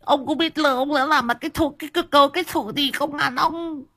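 very high shrill wailing woman, lisping; very high wailing lament of an injured wife. A narrator's reading. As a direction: rant and moan like an injured furious wife. Injured wife wails at husband.

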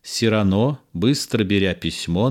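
ordinary talk

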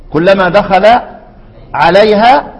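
ordinary delivery

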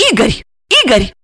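short urgent calls, rising high; high final plea to wake; frantic calling. A narrator's reading. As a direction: try to rouse him, frantically. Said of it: "со стервенением затормошила его Ольга".

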